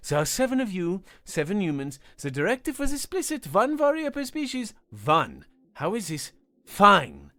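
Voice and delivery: losing his composure over the headcount; rising frustration. A narrator's reading. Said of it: "the frustration he'd been containing".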